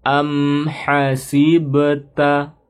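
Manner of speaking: "Am hasibta" chanted slowly, the m clear and short; short izhar reading. Shown as a demonstration. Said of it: "Bacanya pendek ya". ("Am hasibta" dibaca pendek dan jelas)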